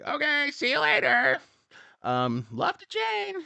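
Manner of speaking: high, slow mock-mother farewell; mom voice. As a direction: drawl a sweet goodbye as mom.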